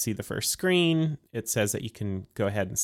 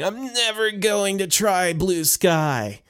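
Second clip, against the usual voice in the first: mocking whiny quote; 8 semitones higher than usual. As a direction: imitate stubborn people mockingly. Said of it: "There are folks out there saying".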